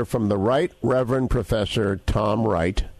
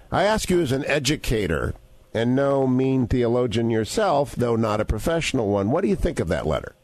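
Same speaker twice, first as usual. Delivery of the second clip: ordinary talk, nothing marked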